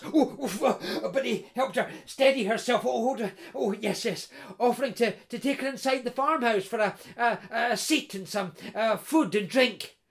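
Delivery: plain reading voice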